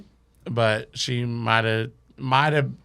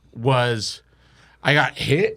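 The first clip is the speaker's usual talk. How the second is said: ordinary delivery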